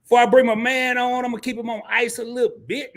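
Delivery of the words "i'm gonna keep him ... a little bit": ordinary talk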